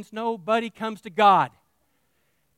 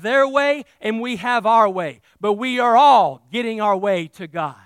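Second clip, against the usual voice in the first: preaching that grows steadily louder; building preaching; 6 dB louder than usual. Acted. Pastor warning his people, rising to a loud preach. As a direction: build the warning up to full force.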